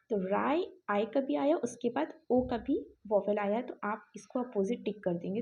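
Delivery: ordinary delivery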